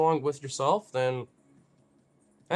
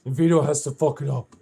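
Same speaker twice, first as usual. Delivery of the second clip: louder, exasperated voicing of a pet; mildly acted frustration; mock frustrated; 5 dB louder than usual. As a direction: complain as the put-upon pet. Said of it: "Chassie's so frustrated. She's like".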